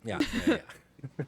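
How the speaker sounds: slow, laughing yeahs. Real, not acted. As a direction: laugh through the words. Laughing at the joke.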